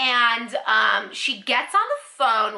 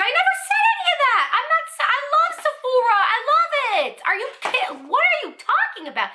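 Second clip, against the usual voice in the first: very high-pitched, frantic retort; outraged protest. Retold or imitated. Customer protests to yelling supervisor she loves Sephora, outraged outburst. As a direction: act out an exasperated squeal of denial.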